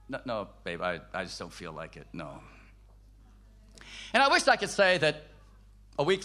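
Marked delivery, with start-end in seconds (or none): 0.1-2.4 very low, soft mumble; quiet, sunken, listless brush-off; dejected refusal. Retold or imitated. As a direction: imitate a depressed husband declining. Replays his depressed refusal to wife.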